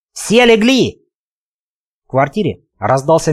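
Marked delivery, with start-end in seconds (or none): 0.1-1.0 sharp command shout, higher; yelling. A narrator's reading. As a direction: bark the order at the room. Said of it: "он заорал".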